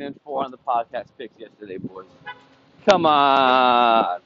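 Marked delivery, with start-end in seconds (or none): 2.9-4.2 loud, fired-up cheer; celebratory shout. Real, not acted. Host cheering his listeners after a winning day, loud shout. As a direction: pump up the listeners after a big win.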